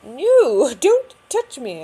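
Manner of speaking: high, squeaky, laughing "don't touch me"; whiny vein voice. Retold or imitated. Veins squeal at the nurse, high and laughing. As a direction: voice the veins pulling away from the needle. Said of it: "They're like".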